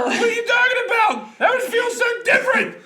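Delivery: high, loud laughing shout; laughing yell. Real, not acted. To her friend about the teeth, a high laughing shout.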